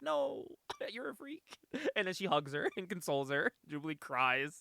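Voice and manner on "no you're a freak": high, softer, slow delivery with a laugh; mock mom verdict. Mom answering her daughter, high slow mock verdict. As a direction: draw it out high, breaking into laughter.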